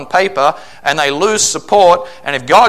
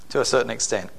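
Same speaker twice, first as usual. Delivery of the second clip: softer repeat of "To a certain extent."; quiet echo; 5 semitones lower, 9 dB quieter, faster than usual. Real, not acted. Preacher echoing his own qualifier to the church, much softer.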